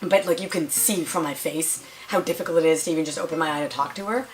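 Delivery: normal talking voice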